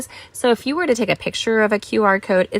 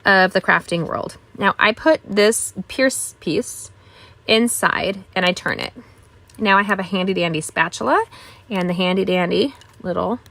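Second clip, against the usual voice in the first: normal talking voice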